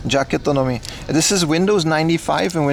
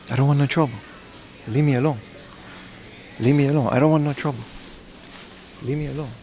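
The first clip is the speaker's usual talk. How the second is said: Jackie Chan impression; 5 dB quieter, faster than usual. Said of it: "I'm gonna do another Jackie Chan impression".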